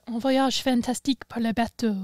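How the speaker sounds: put-on tossed-off French line in a French accent; imitated French. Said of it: "his random French and German he does".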